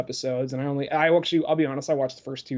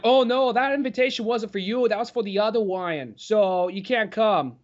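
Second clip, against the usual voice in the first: mock Fudd-like speech; 5 semitones higher than usual. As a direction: say Wyan for Ryan, like Elmer Fudd. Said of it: "say the guy's name in preparation".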